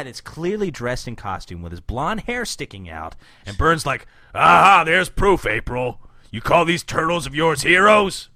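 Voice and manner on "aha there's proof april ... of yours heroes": gloating shout. Retold or imitated. Voicing dumb Burn.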